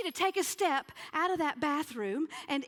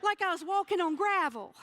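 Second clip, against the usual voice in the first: loud; 5 semitones higher, 3 dB louder than usual. Real, not acted. Describing the loud noise.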